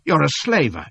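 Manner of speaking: loud horrified accusation; crying out. Acted. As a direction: hurl the discovery at him. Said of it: "cries I".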